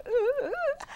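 high, tearful stammering uhs. Retold or imitated. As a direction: stammer through tears, tongue-tied. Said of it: "And I said".

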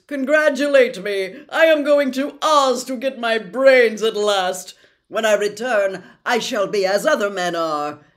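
loud, high, eager straw-man voice; Scarecrow voice. Acted. Scarecrow telling his friends he'll get brains, loud and eager. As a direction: crow it out like a jubilant scarecrow.

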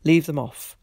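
ordinary delivery, nothing marked

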